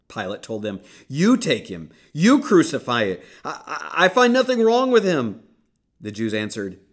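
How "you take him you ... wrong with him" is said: loud, climbing much higher to a strained peak; exasperated outburst. Acted. Pilate refusing the crowd, rising exasperated shout. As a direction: throw it back at them, rising in exasperation.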